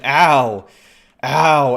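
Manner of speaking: sharp loud ow of pain; pained yelp. Real, not acted. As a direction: yelp as if hit. Hit by an arrow.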